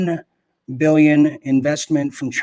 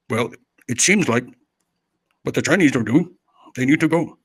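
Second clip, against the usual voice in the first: dubbed kung fu movie. Pretend. Guest can't be heard, host jokingly dubs him like a kung fu movie. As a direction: mimic an out-of-sync kung fu dub. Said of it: "we can do it like a kung fu movie".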